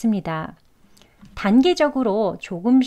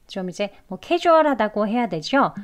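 ordinary talk

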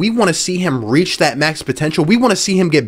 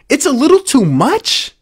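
incredulous echo; 7 semitones higher than usual. Real, not acted. Mocking the quote in disbelief.

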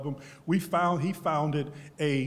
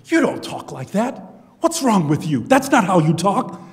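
imitating roommate; 6 semitones higher, 10 dB louder than usual. Roommate confronts him, quoted.

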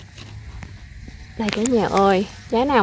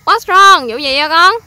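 surprised concern; 7 semitones higher, 10 dB louder, slower than usual. Real, not acted. Mother reacts to child's complaint. (ngạc nhiên lo lắng, giọng cao và to)

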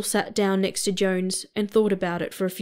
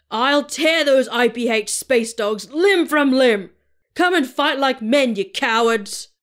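slow, loud, higher bellow; raging shout; 4 semitones higher, 5 dB louder than usual. A narrator's reading. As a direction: roar like a madman. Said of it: "the captain yelled out at the top of his voice".

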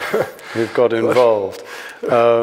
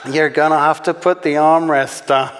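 higher American character voice; wrong character voice; 5 semitones higher than usual. Acted. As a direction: put on a raised American voice, mistaken for the dad's. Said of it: "wrong voice".

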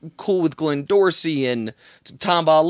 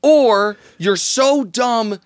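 high, slow, over-the-top accusation; impassioned rant; 5 semitones higher, 4 dB louder than usual. Retold or imitated. Radio host tearing into Tim Tebow on air, impassioned. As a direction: build the radio tirade, stretching it out. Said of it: "impassioned, maybe slightly over the top".

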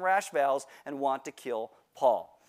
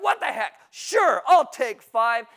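loud, high, the last words slow and pushed; reckless defiance; 7 semitones higher, 8 dB louder, slower than usual. Retold or imitated. Teen recklessly dares his mom to add a week, loud. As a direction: dare her with a shrug in your voice. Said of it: "And I was like".

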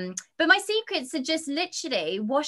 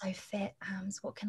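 whisper; 14 dB quieter than usual. Real, not acted. Swooning over her star crush.

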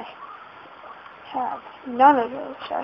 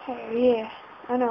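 normal talking voice

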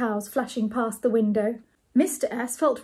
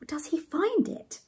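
slow, high-pitched question; Ethel's voice; 8 semitones higher than usual. A narrator's reading. Ethel asks about the money, slow high voice. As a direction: ask slowly in a high character voice.

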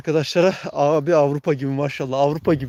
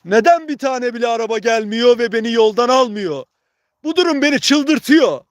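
loud frustrated shout; 6 semitones higher, 5 dB louder than usual. Real, not acted. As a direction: yell in exasperation at the road. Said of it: "Ben arada bağırıyorum".

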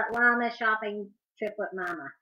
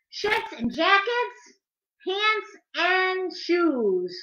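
lively, louder sing-song read-aloud; animated picture-book reading; reading to children; 5 semitones higher, 9 dB louder, slower than usual. A narrator's reading. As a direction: read the rhymes to little kids, bouncing on the beat.

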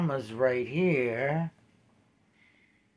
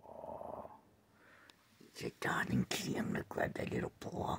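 fast hushed whisper; 12 semitones lower, 10 dB quieter, faster than usual. Real, not acted. Owner whispering as she reaches for the paw. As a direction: whisper it quickly so he stays calm.

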